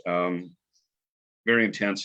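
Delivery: usual speaking voice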